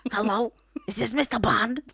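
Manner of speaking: loud, put-on [name] voice; hammed-up [name] villain; [name] impression. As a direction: play [name] as a Bond villain. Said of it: "you sound like [name]".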